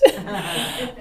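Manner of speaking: quick real chuckle with a rise; a short giggle to round off the joke; brief chuckle. Real, not acted. Host laughs again.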